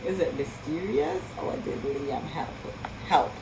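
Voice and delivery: ordinary delivery, nothing marked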